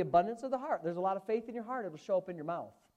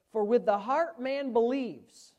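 slow, louder scripture read; preaching scripture; 3 semitones higher, 6 dB louder, slower than usual. Real, not acted. Preacher to congregation, slow emphatic scripture. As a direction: lean on each word of the verse. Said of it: "Romans ten and verse ten says".

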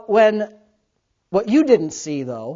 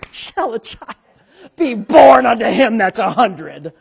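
scoffing Abraham; 6 dB louder, slower than usual. Acted. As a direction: scoff at God like it's a joke. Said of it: "You can see him".

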